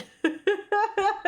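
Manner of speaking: high burst of laughter. Real, not acted. Laughing at TTS line.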